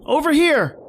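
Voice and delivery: high, loud shout across the snow; shouting through a blizzard. Acted. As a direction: yell to draw him away. Shouting to lure him.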